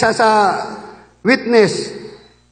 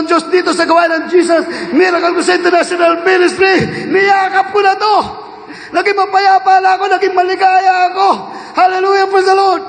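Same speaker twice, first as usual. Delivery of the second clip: raised, ringing preacher's shout; preaching; 5 semitones higher than usual. Real, not acted. Preacher proclaiming God to the congregation, shouting. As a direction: proclaim it to the congregation at full force.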